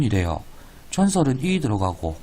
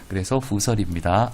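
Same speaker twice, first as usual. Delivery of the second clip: usual speaking voice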